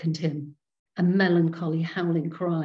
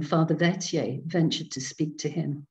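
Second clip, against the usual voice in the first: ordinary delivery, nothing marked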